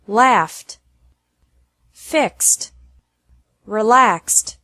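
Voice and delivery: "Laughed, fixed, relaxed" each closing on a crisp t; slow clear teacher model. Shown as a demonstration. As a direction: end on t, keep them one beat shorter. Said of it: "E D is pronounced like T".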